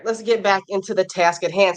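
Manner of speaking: ordinary delivery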